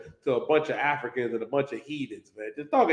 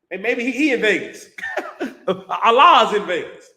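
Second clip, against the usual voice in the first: laughing; 6 semitones higher, 7 dB louder than usual. Real, not acted.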